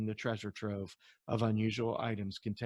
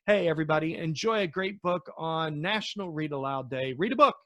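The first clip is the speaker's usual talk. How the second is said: upbeat sign-off; 6 semitones higher, 6 dB louder than usual. Real, not acted. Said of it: "cheerful sign-off".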